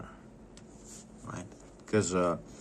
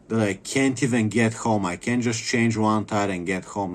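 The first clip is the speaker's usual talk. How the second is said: ordinary talk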